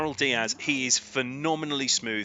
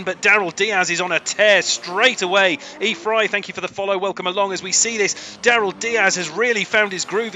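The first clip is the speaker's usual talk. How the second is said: loud, higher, racing commentary; play-by-play commentary; 8 semitones higher, 9 dB louder than usual. Acted. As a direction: call the battle live, building. Live race commentary.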